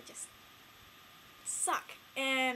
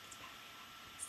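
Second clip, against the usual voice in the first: barely audible whispered verdict; soft, voiceless aside; hushed aside; 19 dB quieter than usual. Retold or imitated. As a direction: whisper it under the breath. Acting out own reaction.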